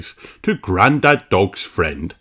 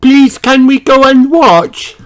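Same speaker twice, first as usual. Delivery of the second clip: put-on high, eager little girl voice; begging to be allowed; Peppa asking; 7 dB louder than usual. A narrator's reading. Peppa asking Mummy Pig to watch, eager high voice. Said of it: "asks Peppa".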